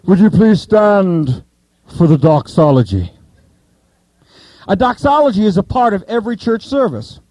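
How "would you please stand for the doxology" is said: churchy religious language. Acted. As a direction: intone it like a formal call to worship. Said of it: "sounds like really religious language".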